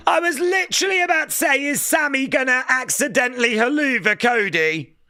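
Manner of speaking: can't believe he called it; excited. Real, not acted. Excited after predicting it.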